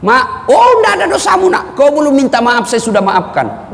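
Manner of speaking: the ustaz replaying his mother's forgiveness, high and doting; gentle mother's voice. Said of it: "Itu enaknya minta maaf sama orang tua".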